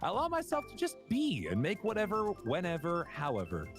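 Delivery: high, measured inspirational recital; affirmation reading. Acted. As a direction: deliver a lofty mock affirmation, climbing at the end. Reads words of encouragement.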